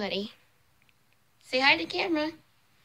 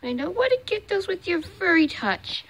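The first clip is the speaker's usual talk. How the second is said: ordinary talk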